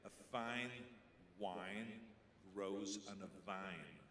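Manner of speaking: slow, careful "fine", "wine", "vine"; diction drill. Shown as a demonstration. Coach makes him drill f, w and v. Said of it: "the coach had me say".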